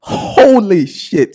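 loud, drawn-out amazed shout; hyped shout. Real, not acted. Reacting to the outfit, an amazed drawn-out shout. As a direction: blown away by the fit.

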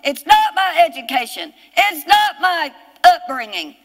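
high, hammered list with NOT punched each time; impassioned preaching. Real, not acted. Preacher listing what is not to blame, forceful declaration. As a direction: fire off each denial from the pulpit.

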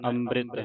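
ਅੰਮ੍ਰਿਤ said as am-brit with a clear b; with a b sound. Shown as a demonstration. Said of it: "ਬੱਬੇ ਦੇ ਨਾਲ ਉਚਾਰਨ ਕਰਨਾ ਹੈ". (ਅੰਮ੍ਰਿਤ ਬੱਬੇ ਨਾਲ)

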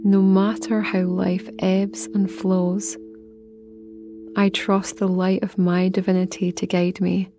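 slow, measured meditation voice with long pauses; guided meditation. Acted. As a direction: guide the listener inward phrase by phrase, unhurried.

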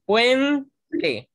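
"puente" in two beats, puen-te, high on the first; syllable by syllable. Shown as a demonstration. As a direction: slowly split "puente" as puen-te. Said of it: "por ejemplo".

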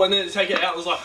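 normal talking voice

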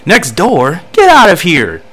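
high, loud jockey voice; character voice. Acted. Jockey answering the trainer about next door, high loud character voice. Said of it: "That's how my character looks like he talks".

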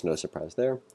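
ordinary talk

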